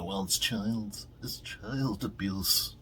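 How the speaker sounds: slow, drawn-out mock complaint; mocking objectors. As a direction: drag the words out to ridicule today's objectors. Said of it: "Now it's like".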